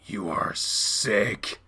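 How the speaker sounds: slow, low, seething contempt; hateful. Acted. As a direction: draw out each word with loathing. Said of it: "Never heard Dad speak with so much hate".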